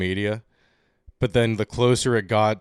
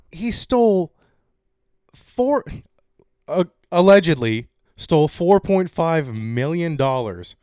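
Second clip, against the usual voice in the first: incredulous; 6 semitones higher, 5 dB louder than usual. Real, not acted. Disbelief at stolen amount.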